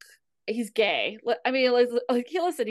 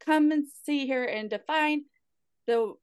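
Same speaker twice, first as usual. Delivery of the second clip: mocking Tila; 8 semitones higher, 6 dB louder than usual. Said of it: "she's like".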